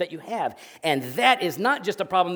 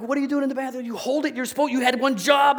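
high, worked-up scolding building louder; retold yelling; 10 semitones higher, 5 dB louder than usual. Dad scolding son in the bathroom, yelling. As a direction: re-enact an exasperated dad's tirade. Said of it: "And so I'm yelling at him".

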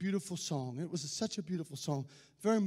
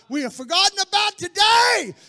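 loud, high shouted sermon build; fiery preaching; 9 semitones higher, 15 dB louder than usual. Acted. Preacher shouting to his congregation, fiery and loud. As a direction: preach it to the rafters, pounding each phrase.

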